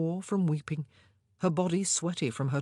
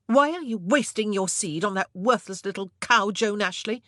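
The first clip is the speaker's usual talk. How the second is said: loud, furious accusation; raging; 5 semitones higher, 6 dB louder than usual. A narrator's reading. Queen confronting the king over his mistress, furious. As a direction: spit out the insult. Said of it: "Rage consumed her".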